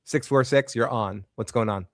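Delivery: ordinary delivery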